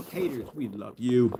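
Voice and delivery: soft, drawn-out mock affection; mock-tender aside. Vlogger signing off to haters, mock-tender aside. Same sign-off, aimed at haters.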